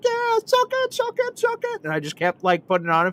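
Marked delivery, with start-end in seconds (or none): 0.0-1.8 very high falsetto taunt; relentless gloating. Retold or imitated. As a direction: keep needling in a squealed mock cheer. Re-enacts relentless taunting of dad.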